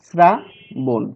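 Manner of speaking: Shrabon broken in two, শ্রা then বন, with a clear pause; split into syllables. Shown as a demonstration. Teacher dividing the word for students, Shrabon split as শ্রা, pause, বন. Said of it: "সিলেবলে এই শব্দটিকে ভাগ করি".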